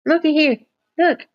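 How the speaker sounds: high cooing voice for the dogs; pet talk. Real, not acted. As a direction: coo at the dog to look over here. Showing the dogs on camera.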